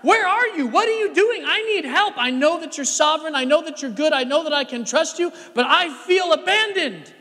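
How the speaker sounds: high, loud cry out to God; hammed-up anguish of a man on the run, pleading; desperate plea. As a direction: shout your prayer upward, urgent and high. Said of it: "on the run from Saul".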